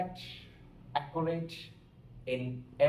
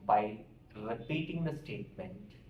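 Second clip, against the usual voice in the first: ordinary delivery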